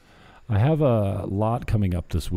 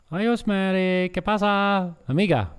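put-on higher, bouncy Spanish accent greeting; 11 semitones higher, 3 dB louder than usual. Greeting Yosmeri in a put-on, higher Spanish accent. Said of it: "taking on the Spanish accent".

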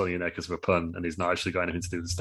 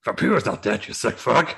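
mock outrage; 3 semitones higher, 5 dB louder than usual. [name] outraged.

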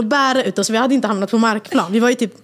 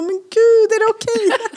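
high-pitched, laughing mock disbelief; härmat skrattande; 12 semitones higher, slower than usual. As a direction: imitate someone cracking up while asking, voice shooting way up. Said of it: "Alltså hon skrattade". (härmat skrattande i gäll falsett)

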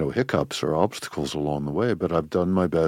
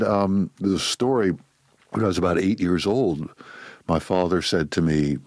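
ordinary talk

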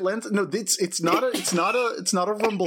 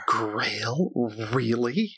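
disdainful; 5 semitones lower, much slower than usual. Acted. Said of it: "wrinkles her nose at you and goes".

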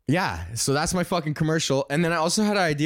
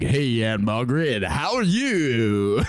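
put-on toddler as smooth radio DJ; hammed-up announcer swoop; 3 semitones lower, slower than usual. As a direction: greet like a broadcaster, stretching the last words. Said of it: "kinda radio voice".

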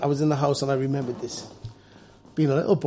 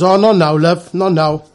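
dismissive shop lady; 3 semitones higher, 9 dB louder, slower than usual. Retold or imitated. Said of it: "she goes".

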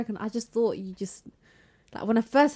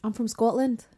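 light Scottish accent demo. Shown as a demonstration. Repeating a given line for friends, Scottish accent. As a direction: put Scottish sounds on the line. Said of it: "I can do a bit of a Scottish accent".

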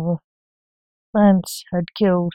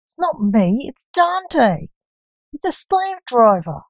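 whiny moan; 7 semitones higher, slower than usual. Acted. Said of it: "moaned Mortimer".

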